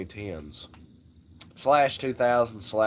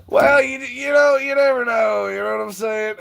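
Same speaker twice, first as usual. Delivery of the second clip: much higher and louder, near shouting; loud exclaiming; 12 semitones higher, 7 dB louder than usual. Real, not acted. Hyping up the good old boy to friends, shouting. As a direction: burst out with it.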